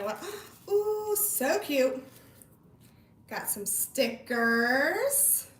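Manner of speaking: ordinary talk, nothing marked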